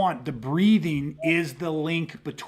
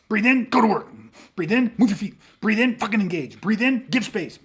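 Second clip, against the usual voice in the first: raised, punchy self-commands after quick breaths; breath-linked commands; 5 semitones higher, 4 dB louder than usual. Shown as a demonstration. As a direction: fire each order off the breath. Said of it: "there's a command that goes with it".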